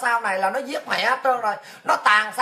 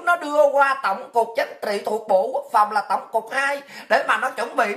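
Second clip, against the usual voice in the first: ordinary delivery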